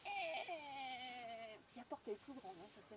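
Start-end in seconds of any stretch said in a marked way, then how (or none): none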